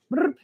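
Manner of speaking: short rolled cat chirp; cat trill. Acted.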